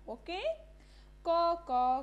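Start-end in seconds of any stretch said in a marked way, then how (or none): 1.2-2.0 loud, higher cawing like a crow. Retold or imitated. Teacher to class, imitating the crow's loud caw. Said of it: "says the crow".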